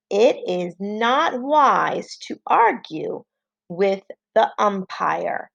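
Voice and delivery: measured, slow classroom dictation. Shown as a demonstration. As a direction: read the sentence out slowly and carefully. Teacher dictates a sentence.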